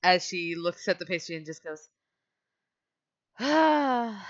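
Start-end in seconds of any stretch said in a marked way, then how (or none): 3.4-4.3 loud, high drawn-out "ah"; loud groaning sigh. Acted. Brooke looking at her pastry, loud groan. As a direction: let out a big exasperated sigh.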